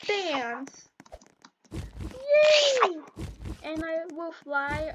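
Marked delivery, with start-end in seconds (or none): 1.7-3.0 high, loud yay shout; happy shout. Real, not acted. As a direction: yell it out with joy. Dancing at party.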